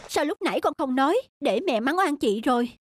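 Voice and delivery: usual speaking voice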